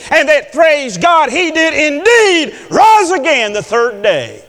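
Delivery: building to high, loud, stretched proclamation; fervent preaching testimony. Real, not acted. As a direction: swell into a ringing declaration of faith.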